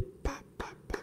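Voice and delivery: spaced, voiceless "pá" claps; imitated hand claps. Pastor clapping to silence the church, imitated claps. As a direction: mimic the sound of strong clapping. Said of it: "bateu palma forte".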